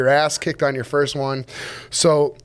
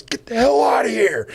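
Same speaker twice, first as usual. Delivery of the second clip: mock gruff brush-off; 8 semitones higher than usual. [name] rebuffs him jokingly.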